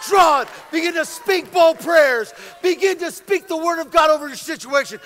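loud, driving preacher's delivery; fired-up preaching. Real, not acted. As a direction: preach it with full force, punching key phrases. Preaching at full force.

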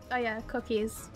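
normal talking voice

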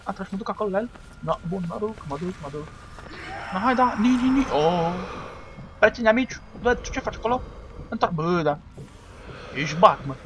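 usual speaking voice

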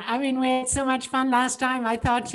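usual speaking voice

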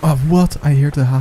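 sudden high loud what; surprised. Real, not acted. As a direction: burst out in surprise. Surprised by opponent.

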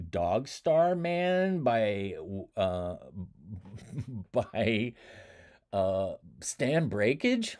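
slow, unsure, groping for the name; halting title. Retold or imitated. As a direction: feel your way through the title. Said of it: "stop me right then and go".

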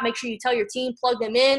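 ordinary delivery

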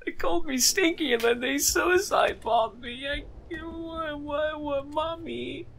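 high, hammed-up fake sob to mommy; mock crying. Gamer fake-cries to mommy after enemies call him stinky, mock sobbing. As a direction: whimper like a wronged child, faking it.

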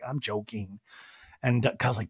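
ordinary delivery

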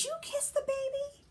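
very high, quick squeaky question. Acted. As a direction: ask it in a tiny high voice.

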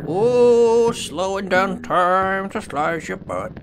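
drawn-out slow-mo words, opening high and loud; slow-motion voice. Pretend. Player slowing down time to slice someone, slow-motion drawl.

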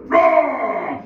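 high, loud mock bear roar; hammed-up beastly roar. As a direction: roar like a hungry, angry bear. Bear roars.

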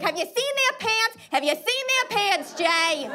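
high, insistent put-on voice; mock indignant retort. Arguing with dad over the teachers' pants, mock indignant. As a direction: repeat it as an incredulous argument, pitched high.

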